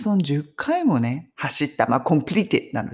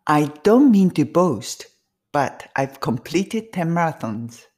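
a bit faster; much slower than usual. Shown as a demonstration. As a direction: pick up the pace for the second round. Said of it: "ちょっと早めに行きます".

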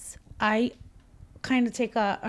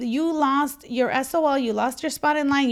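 ordinary talk, nothing marked

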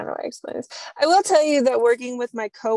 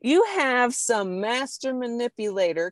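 normal talking voice

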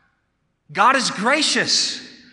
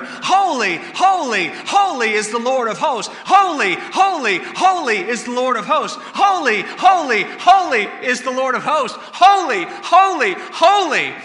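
loud angelic shouts; 4 dB louder than usual. Acted. Preacher voicing angels calling, loud shouts. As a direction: shout it like seraphim calling across heaven. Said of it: "calling out to one another with loud shouts".

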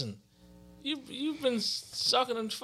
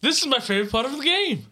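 loud, raised-pitch hype; excited show-host shout; hyped-up; 5 semitones higher, 8 dB louder, faster than usual. Real, not acted. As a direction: kick off the game segment with gusto. Hyping the game segment.

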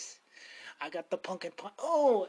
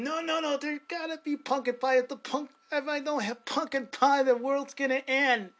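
higher, half-joking frantic inner voice; mock panic; 6 semitones higher, 4 dB louder than usual. Retelling his inner panic over no pumpkin pie, mock frantic. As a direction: play up the silly panic over a missing pie. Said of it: "in my mind, I was thinking".

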